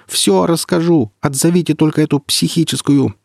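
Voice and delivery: panicked pleading. Acted. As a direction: beg them to call her off. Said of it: "орала голова благим матом".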